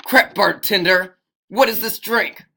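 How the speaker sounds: loud, high angry demand; hammed-up rage at a bartender. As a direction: yell it with full gusto. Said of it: "say it with more enthusiasm".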